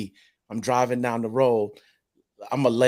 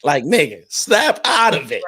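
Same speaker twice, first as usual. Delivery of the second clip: louder self-scolding; 3 semitones higher, 7 dB louder than usual. Retold or imitated. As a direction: bark it at yourself, drawn out. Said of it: "I'm forced to ask myself".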